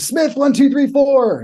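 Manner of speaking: high, loud barked count; drill instructor scream. Retold or imitated. Drill instructor screaming roll call at recruits. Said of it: "And the guy screams".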